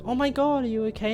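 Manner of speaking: high, startled concern; worried little girl. Retold or imitated. Abby fussing over a fallen Bonnie, high and startled. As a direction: squeak it out like a worried kid.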